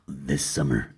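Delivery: deep movie trailer opener. Pretend. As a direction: say it like a blockbuster trailer. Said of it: "Movie voice time".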